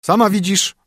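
husband giving his new wife chores, mildly stern. A narrator's reading. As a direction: order her about, lightly stern. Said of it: "rzekł mąż".

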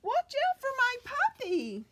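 Watch out for my puppy yelled high and loud; storybook yell. Acted. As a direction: shout a warning across the ice. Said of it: "I yelled".

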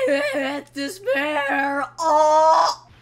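high, loud, slow despairing wail; hammed-up despair. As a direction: wail like a doomed man. Voicing defendant's despair.

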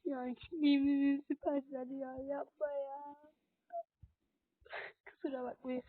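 soft, fading tearful voice; quiet crying, trailing away; tearful pleading. Real, not acted. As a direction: plead weakly, drawing out the words. Tearful pleading after losing.

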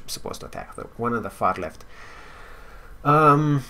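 ordinary delivery

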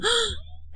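shocked gasp. Acted. Sister shocked at insult.

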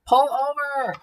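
loud, high shouted order; bullhorn shout. A narrator's reading. Officer ordering the car to stop, bullhorn shout. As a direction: holler it as if through a bullhorn. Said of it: "He shouted into his bullhorn".

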